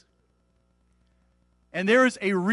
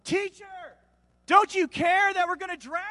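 high-pitched cry of alarm; panicked shout; 12 semitones higher than usual. A narrator's reading. Disciples waking Jesus in the storm, panicked shouting.

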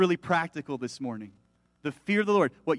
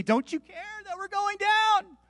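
high, drawn-out desperate wail; panicked cry; 14 semitones higher, slower than usual. Acted. Disciples turning to Jesus in the storm, panicked cry. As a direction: plead in alarm, pitched way up.

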